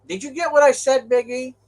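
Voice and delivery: high, loud friendly call; hearty greeting. Real, not acted. Trucker greeting his friend Jack, high and loud. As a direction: hail a buddy.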